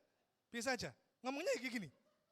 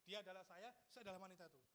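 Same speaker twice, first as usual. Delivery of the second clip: usual speaking voice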